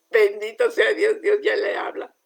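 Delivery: loud, exultant praise; joyful exclamation. Retold or imitated. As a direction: cry out the blessing with joy. Retold joyful exclamation to pastor. (exclamación alegre y fuerte)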